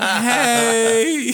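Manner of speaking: high, loud, caught-out hello; awkward greeting. Retold or imitated. Students caught lighting up greet teacher, awkward greeting.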